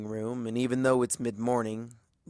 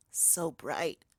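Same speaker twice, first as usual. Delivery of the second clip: dazed murmur; 5 semitones higher, 5 dB quieter than usual. Acted. Twilight dazed after nightmare.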